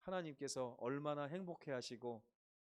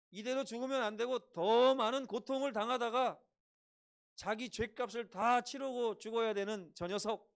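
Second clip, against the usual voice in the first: loud, higher condemning cries; crowd's curses; 6 semitones higher, 7 dB louder than usual. Acted. Crowd cursing the thief on the cross, angry loud. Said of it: "뭐라고 그래요".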